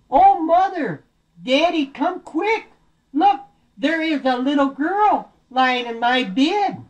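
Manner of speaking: put-on very high, slow baby bear cry; alarmed Baby Bear. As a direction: squeal the discovery to the parents. Baby Bear discovers girl in bed.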